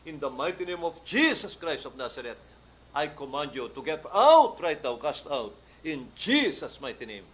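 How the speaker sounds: preacher rebuking a demon; rebuking command. Retold or imitated. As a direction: cast the demon out with full force. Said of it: "nirebuke ko yung demonyong cancer".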